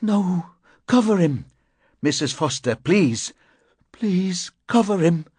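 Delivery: high, pleading cries; a desperate wife crying out. Acted. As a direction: beg through tears to cover the body. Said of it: "she cried".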